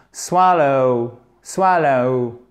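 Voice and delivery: "swallow" drawn out, long first vowel and gliding final diphthong; model English pronunciation. Shown as a demonstration. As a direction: model "swallow" slowly, letting the ending glide to ou. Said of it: "suivi d'une diphtongue". (« swallow » articulé lentement, diphtongue finale glissée)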